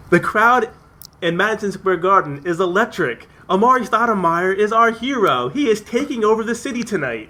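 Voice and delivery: high, excited play-by-play call; imitating announcer Marv Albert. Podcaster quoting the game announcer, excited call. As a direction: hype up the arena like a broadcaster. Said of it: "it's saying".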